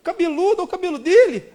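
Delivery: high, mocking quote of the girl; high mock disapproval. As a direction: sneer at the long-haired boy. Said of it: "ela falava".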